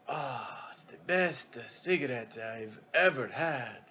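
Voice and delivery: put-on slow, soft, savoring Italian-accented English; drawn-out Italian accent like tasting fine wine; hushed Italian accent with a sigh; Italian broken English. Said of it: "Italian, you know, American broken English".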